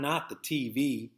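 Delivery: plain reading voice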